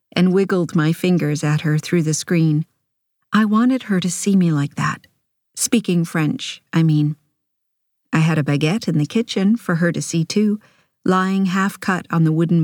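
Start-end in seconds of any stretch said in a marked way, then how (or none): none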